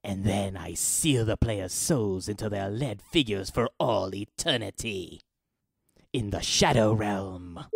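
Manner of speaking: normal talking voice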